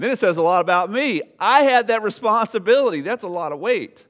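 loud, high and awed; awed emphasis. Real, not acted. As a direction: marvel at the weight of it. Awe at shepherd responsibility.

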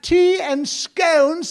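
high, loud, stretched-out mock British; British accent. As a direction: relish the words in a put-on British accent. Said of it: "talking with a British accent".

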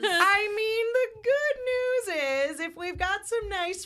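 high, slow giggly voice; laughing. Real, not acted. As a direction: talk through a sly laugh. Joking about piracy source.

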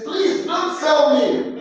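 high, slow, begging plea; high pleading cry. Acted. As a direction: beg for your life, drawn out and high. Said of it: "Joseph was pleading, begging".

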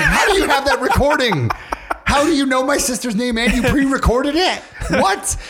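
cracking up while baffled; laughing high disbelief. Pretend.

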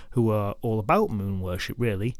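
plain reading voice

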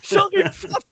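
very high, incredulous squeal through laughter; laughing outrage. Real, not acted. Storyteller losing it over the driver's act, helpless high laughter. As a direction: retell it while cracking up. Recounting table cracking up laughing.

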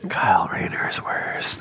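hushed whisper, barely voiced. Real, not acted. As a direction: say it under your breath. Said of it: "Why are we whispering?".

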